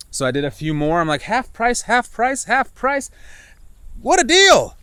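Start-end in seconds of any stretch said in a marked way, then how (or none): 1.2-4.7 high, giddy mock voice, much higher and louder; gleeful shopper. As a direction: squeal over the bargains.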